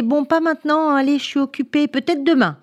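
busy father's brush-off. A narrator's reading. Busy father putting off Anna's question, dismissive.

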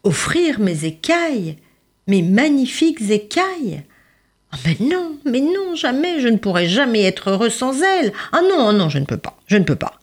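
high, horrified refusal; hammed-up mais non jamais; indignant refusal. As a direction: refuse outright, aghast. Refusing to give scales.

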